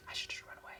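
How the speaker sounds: unvoiced whispered thought. Nervous wrestler at the boss's door, whispering. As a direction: whisper it to yourself.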